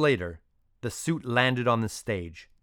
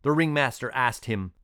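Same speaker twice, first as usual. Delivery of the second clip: plain reading voice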